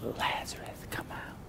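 breathy, almost silent whisper of the name; timid whisper. Shown as a demonstration. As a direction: say it like you doubt it will work. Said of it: "I don't think he was like".

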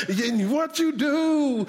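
high, slow chanted phrase; mock whooping sermon cadence; imitated preacher tuning up. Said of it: "when you tune up".

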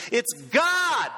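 triumphant preacher's cry; emphatic shout. Real, not acted. Preacher declaring the winner.

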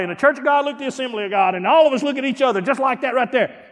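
fired-up preacher voice; full-force preaching. Acted.

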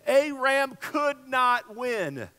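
slow, deliberate punch on each word; slowed, emphatic preacher's point; slow emphasis. Real, not acted. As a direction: land it word by word.

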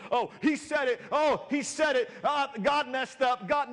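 high, loud mock panic; imitating a flustered believer who thinks God erred; panicked churchgoer. Preacher mimics a churchgoer panicking over his words, high and loud. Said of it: "Everything I say is not you going".

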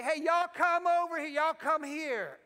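loud, high call across a distance. Acted. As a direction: holler to gather people over. Imitates calling people into church.

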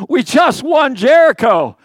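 high, loud, drawn-out protest; indignant disbelief. Acted. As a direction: cry it out in mock outrage. Voicing Israelites' disbelief at defeat.

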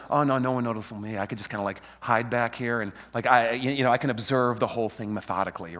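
low, soft mock mumble; imitating back-row hiders. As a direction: lie low, keep it small. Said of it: "they sit back there and they go".

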